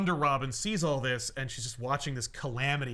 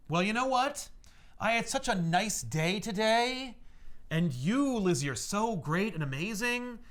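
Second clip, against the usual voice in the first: raised pitch, slow and emphatic speech; heartfelt declaration; 6 semitones higher than usual. Retold or imitated. Butler thanks doomed-wedding visitor, emphatic heartfelt speech. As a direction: stretch the key words like a grand tribute.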